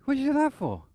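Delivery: high, loud startled cry; shocked shout. Retold or imitated. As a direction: jump back and demand why. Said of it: "He goes".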